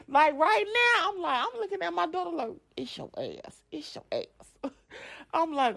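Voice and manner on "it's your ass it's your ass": muted threat. Retold or imitated. Silent threat to daughter.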